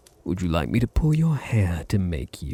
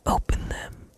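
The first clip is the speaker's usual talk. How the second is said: soft whispered command; a breath of an order; 4 dB quieter than usual. Acted. Vampire commanding pet to open eyes, whispering.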